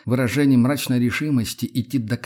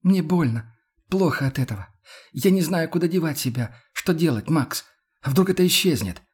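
higher voice, close to tears; distressed Yana; 6 semitones higher than usual. Acted. Yana fears it will all vanish, distressed. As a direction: plead helplessly, pitched up. Яна сдерживает слёзы, в отчаянии.

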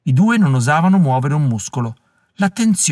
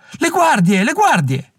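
high cry of alarm; alarmed shout; 11 semitones higher, slower than usual. A narrator's reading. Someone in the crowd spotting the guards, high alarmed cry. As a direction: warn everyone the guards are here. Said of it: "Qualcuno gridò".

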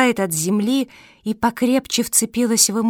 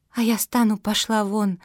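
ordinary delivery

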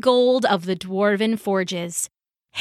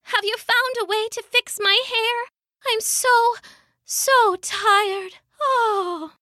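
high and weary; tired, fading plea; weak; 11 semitones higher than usual. Acted. Ailing Sif asks Loki for her hair, weak and high. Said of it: "Sif said weakly".

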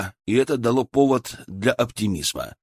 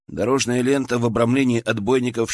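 ordinary delivery

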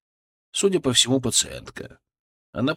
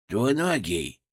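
pleased; slower than usual. Acted. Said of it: "голос Фырка был довольным".

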